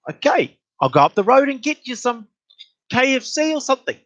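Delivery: high-pitched exasperated offer. Retold or imitated. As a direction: offer a fix as if at wit's end. Acted quarrel, offering a solution.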